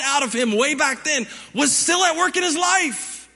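high, driving sermon delivery; impassioned preaching. Acted. Pastor urging congregation about God's calling, impassioned preaching. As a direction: preach it with rising force.

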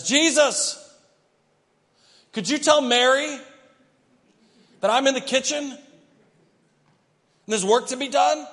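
loud, high, exasperated demand; calling out in annoyance across the room; irritated Martha. Acted. Martha, fed up, loudly demands Jesus send Mary to help. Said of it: "Martha is so irritated".

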